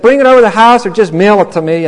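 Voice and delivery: high, loud, happy offer; eager mentor. Retold or imitated. Mentor gladly offers to sign the grant card, high and loud. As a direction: make it a bright, eager yes, high and loud.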